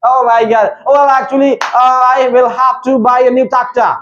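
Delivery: very slow, stretched English; slow put-on English. Shown as a demonstration. Teacher shows students how English should sound, very slow. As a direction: savour each word like a posh speaker. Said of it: "ऐसे बोलो अंग्रेजी लगे".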